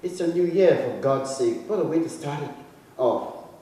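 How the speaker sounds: ordinary delivery, nothing marked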